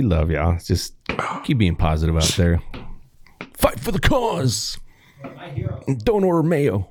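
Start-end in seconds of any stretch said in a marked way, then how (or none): 3.5-6.9 rally them with a high, stretched-out slogan; mock rallying cry. Signing off to everybody out there, mock rallying cry.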